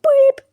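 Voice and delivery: a cartoon button beeping high; high loud beep. Mimicking a button beep in a cartoon recap. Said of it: "I'm gonna make impressions of the characters".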